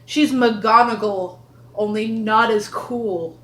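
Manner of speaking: slow, authoritative British matriarch; forceful, slightly British schoolmistress voice; Lachesis. Shown as a demonstration. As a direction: stretch the words out, prim and stiff. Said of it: "be slightly British".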